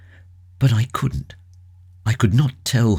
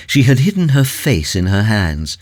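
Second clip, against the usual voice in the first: plain reading voice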